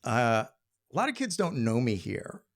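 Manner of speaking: usual speaking voice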